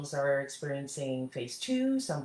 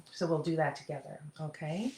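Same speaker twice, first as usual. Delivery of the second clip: normal talking voice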